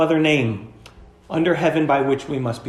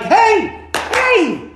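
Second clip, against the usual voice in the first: high, loud shout of alarm; warning scream; 4 dB louder than usual. Acted. Churchgoer warning a member about a charging wolf, shouting. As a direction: yell to stop someone in danger. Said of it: "You're gonna scream".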